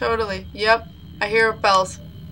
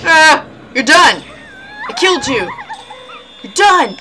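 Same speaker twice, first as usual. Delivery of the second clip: loud triumphant yells; victory shouting; 6 semitones higher, 7 dB louder than usual. Real, not acted. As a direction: shout in triumph over the kill. Killing the ghost, victory yells.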